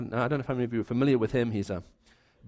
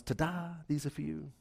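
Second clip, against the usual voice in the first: softer, understated ta-da; 9 dB quieter than usual. Retold or imitated. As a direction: hand over the flowers quietly, no fanfare. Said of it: "I knock on the door and I present the flowers".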